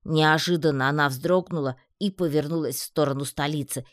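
ordinary delivery, nothing marked

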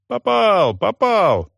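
clamouring shout. Acted. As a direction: yell it out in triumph. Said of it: "заголосили". (громкие ликующие крики)

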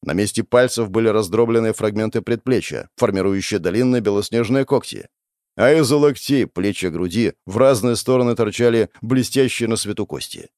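plain reading voice

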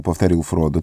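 ordinary delivery, nothing marked

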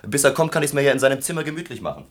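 laut und schnell, frech auftrumpfend; lauter Trotz. Acted. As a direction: dreist in den Raum rufen. Student bullies past secretary. (frecher Student übertönt die Sekretärin, laut und schnell)

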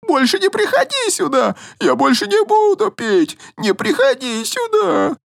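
very high frantic cry; panicked yelling. Acted. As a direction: scream at the monster to go. Drunk keeps screaming.

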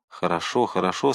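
calm him down softly; soothing. Acted. Athos calms the drowning man. (мягко успокаивая)

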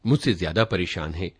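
ordinary delivery, nothing marked

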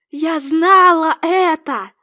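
high, loud, slow triumph; heated rasping cry. A narrator's reading. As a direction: draw out the accusation triumphantly. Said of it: "сипела Джудит своим хриплым голосом". (торжествующий сиплый выкрик)

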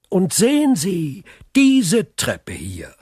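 dramatic reveal. Acted. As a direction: present it like a dark discovery. Count stages an eerie tale. (dramatisch gesteigert, gedehnt)

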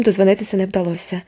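plain reading voice

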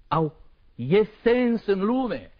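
slow, high, loud cry; desperate question. Acted. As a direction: wail the question in despair. Poet cries out doubting life's meaning.

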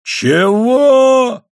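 very high, loud drawn-out bellow; stunned yell. A narrator's reading. Flabbergasted husband challenging his wife, yelling. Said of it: "заорал муж, на миг ошарашенный".

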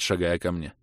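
ordinary delivery, nothing marked

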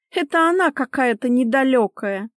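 high-pitched dismissive remark; huffy wife. Acted. Wife blames the other woman, high huffy voice. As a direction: put her down in a high, huffy voice.

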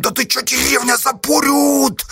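high, strained stage yell held back; frantic high hushed cry; screamed whisper. A narrator's reading. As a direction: wail it under the breath, stretching the last word. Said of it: "завопил шёпотом".

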